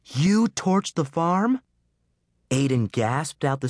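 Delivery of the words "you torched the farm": higher, slow incredulous question; shocked disbelief. Acted. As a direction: stretch it out in astonishment. Meg goggled.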